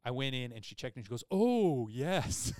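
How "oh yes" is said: put-on high, loud Filipino-accented exclamation; imitated Filipino accent. Dentist checking an infected mouth, imitated Filipino accent. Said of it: "in her accent".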